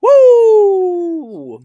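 high loud cheer; excited woo. Real, not acted. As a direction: whoop it up like a fan. Cheering for Turkey Day.

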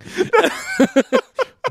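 squealing high laugh; delighted high-pitched cackle; high burst of laughter. Real, not acted. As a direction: let a big high laugh out. Laughing at the voicemail.

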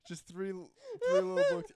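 high, loud laughter breaking into the words; can barely get it out for laughing; laughing hard. Real, not acted. Friend laughs helplessly explaining the Bible story, laughing.

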